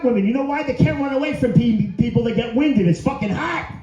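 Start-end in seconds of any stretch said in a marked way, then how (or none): none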